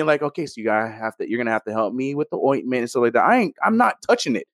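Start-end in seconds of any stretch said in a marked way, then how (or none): 0.2-3.2 low, soft quote of his partner; quoting his partner. Retold or imitated. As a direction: lower and softer, relaying her request. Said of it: "she's like, she telling me".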